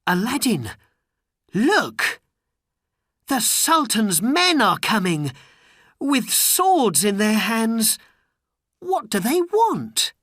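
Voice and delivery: high, urgent warning to Aladdin; a friend crying out as soldiers approach; startled companion voice, pitched high; alarmed friend. Acted. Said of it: "a friend said".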